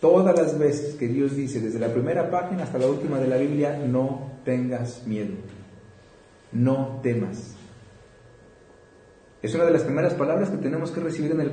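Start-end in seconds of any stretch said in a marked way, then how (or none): 3.8-7.5 slow gentle. Shown as a demonstration. Quoting God's words from Bible.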